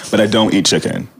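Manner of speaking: ordinary talk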